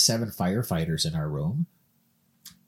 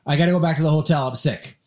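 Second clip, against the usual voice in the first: higher, louder and fast, close to panic; panicked rush; 6 semitones higher, 5 dB louder, faster than usual. Retold or imitated. Man high on edibles begging friends to leave dinner, frantic fast. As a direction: blurt it out fast like you need to leave now.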